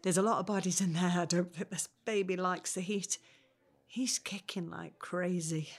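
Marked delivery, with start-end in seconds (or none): 3.9-5.7 breathy whisper, slow; hushed, slowed, to herself; whispered aside. Acted. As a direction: confide it under your breath. Pregnant woman overheated, confiding.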